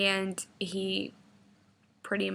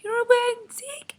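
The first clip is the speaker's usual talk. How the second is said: put-on very high, loud, childlike pleading question; mock a grown man begging to play; imitated eager boyfriend; 6 dB louder, faster than usual.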